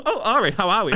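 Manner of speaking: high, laughing mock camp hello; camp greeting. Greeting Ari in a camp voice, high and laughing. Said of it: "really camp".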